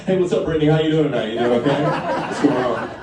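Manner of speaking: loud, high, chirpy hello; overly cheery greeting. Retold or imitated. Pissing man greets passing friend, loud and cheery. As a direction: call out brightly like nothing's wrong.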